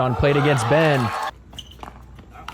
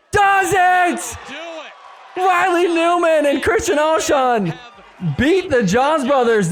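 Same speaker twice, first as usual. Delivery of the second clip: excited call; 12 semitones higher, slower than usual. Acted. Host calling the match point win, excited shout.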